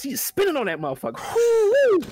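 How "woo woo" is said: loud high woo-woo; hyped cheer at the screen; excited whoop. Real, not acted. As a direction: whoop like at a show. Cheering at the video.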